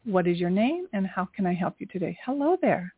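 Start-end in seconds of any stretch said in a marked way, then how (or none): none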